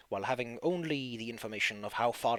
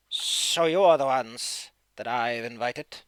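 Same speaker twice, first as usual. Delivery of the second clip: crackly voice; 7 dB louder than usual. Acted. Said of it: "speaks in a crackly voice".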